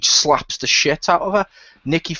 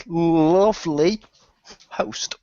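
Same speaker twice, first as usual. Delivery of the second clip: teasing; much slower than usual. Acted. Teasing intro of the host.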